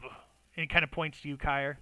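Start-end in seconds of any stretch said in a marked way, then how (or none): none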